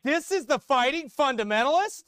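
high, loud scornful rant; loud sarcastic tirade; mocking shout. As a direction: shout down a coward preacher. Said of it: "I'm like".

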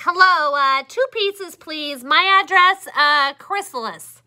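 phone order. Acted. Caterpillar phones in a pizza order, cheerful.

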